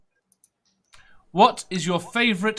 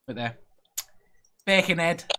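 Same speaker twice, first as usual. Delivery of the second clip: limited Scouse accent attempt. Shown as a demonstration. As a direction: say it like a Liverpudlian, in Scouse. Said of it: "All I can do is I can say".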